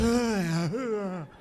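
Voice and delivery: low, heavy wheezing breaths; labored gasping. Retold or imitated. Dad on the couch with afib, labored gasping. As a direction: imitate a winded man with afib. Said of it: "he's got afib".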